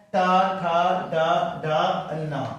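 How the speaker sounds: ट, ठ, ड, ढ, न recited one by one, tongue curled to the palate; teacher sounding out ट, ठ, ड, ढ, न from the roof of the mouth; retroflex letter demo. Shown as a demonstration. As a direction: separate retroflex syllables ट, ठ, ड, ढ, न with pauses. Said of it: "ट वर्ण आपका मूर्धा से उच्चारण होते हैं".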